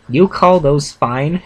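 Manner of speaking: slow, haughty sneer. A narrator's reading. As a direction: drawl it with disdain. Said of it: "she said haughtily".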